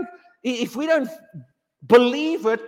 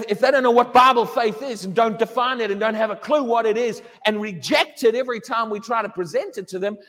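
normal talking voice

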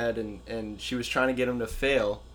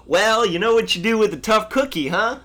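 higher, louder folksy coach voice; imitating Ted; 6 semitones higher, 8 dB louder than usual. Quoting the coach's milk joke, higher and louder. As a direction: put on Ted's cheery coach lilt. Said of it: "Ted goes".

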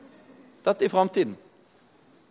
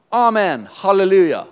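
higher, louder amen and halleluja; elders' exclamation, raised and ringing; exclaimed praise; 7 semitones higher, 8 dB louder than usual. Acted. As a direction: proclaim it with joy.

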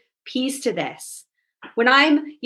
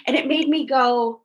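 normal talking voice

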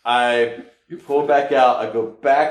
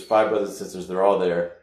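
usual speaking voice

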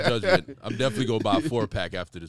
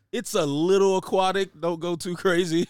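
high, loud mock sales voice; imagined car salesman; 9 semitones higher, 5 dB louder than usual. Beamer telling buyers about the car, high mock voice. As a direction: pitch it up as mock car advice. Voicing a car dealer's advice.

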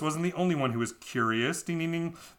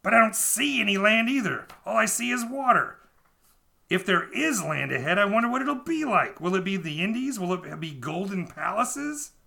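high, louder voiced sailor wondering; 7 semitones higher, 3 dB louder than usual. A narrator's reading. A sailor staring at the sea, in a high wondering voice. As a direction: play a wide-eyed sailor dreaming of gold. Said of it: "said a third man".

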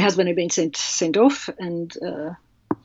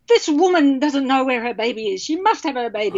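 higher-pitched insistent demand, imitated; mock calling-out, urgent but not shouted; storming and calling out; 6 semitones higher than usual. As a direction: call down the hospital aisle for action. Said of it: "Went storming down the, the aisle, you know, calling".

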